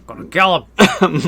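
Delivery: mock murderous. Friend adds the husband's threat, mock menace. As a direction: play the jealous husband vowing revenge.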